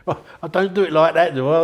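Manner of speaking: retold friend laughing while he objects. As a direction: chuckle through the denial.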